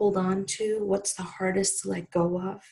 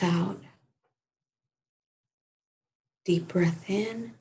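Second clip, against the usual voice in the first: calm meditation voice with long pauses; guided meditation. Real, not acted. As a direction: gently guide listeners through breathing, unhurried. Leads a gratitude meditation.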